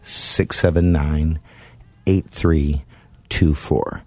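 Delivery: digits in small groups, long pauses between; slow dictation. Real, not acted. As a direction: dictate the number slowly for someone writing it down. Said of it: "I'll try to say it slowly".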